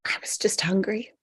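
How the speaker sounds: weak whispered lie. Acted. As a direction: breathe it out, barely holding on. Annie hiding her sickness.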